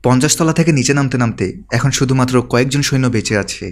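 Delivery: plain reading voice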